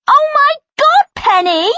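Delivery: high, loud horrified shriek. A narrator's reading. Megan sees her bad photo, shrieking high and loud. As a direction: squeal it in shock. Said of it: "Megan shrieks".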